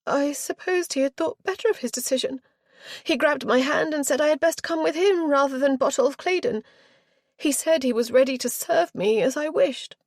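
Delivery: put-on high, loud aggrieved girl; petulant young girl. A narrator's reading. Spoilt girl justifying her flight to the aunt, aggrieved. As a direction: whine it out indignantly.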